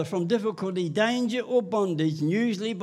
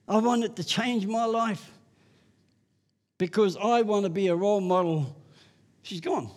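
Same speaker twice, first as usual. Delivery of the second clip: normal talking voice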